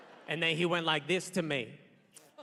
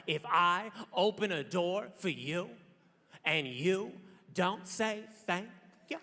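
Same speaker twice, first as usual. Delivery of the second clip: drawn out with deliberate pauses; measured mock vow, halting before the last words; slow, solemn warning; slower than usual. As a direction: spell out the threat bit by bit. Solemn mock vow, spelled out slowly.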